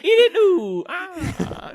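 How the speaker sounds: Mario impression. Shown as a demonstration. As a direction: squeak it out like Mario. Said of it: "a hundred percent Mario".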